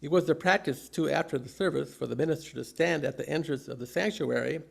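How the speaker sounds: normal talking voice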